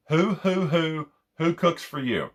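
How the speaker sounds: high, hooting barred owl imitation; showing the owl call by voice. Shown as a demonstration. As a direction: hoot it up high like an owl in the trees. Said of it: "that's how you use a hoot owl caller".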